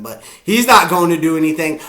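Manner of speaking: normal talking voice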